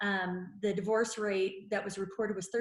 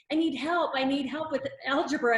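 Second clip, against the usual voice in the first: put-on higher, louder pleading kid voice; whiny child asking for help; 5 semitones higher, 6 dB louder than usual. Wife imitating her child begging for algebra help, higher and louder. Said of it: "a child waving at me in the window saying".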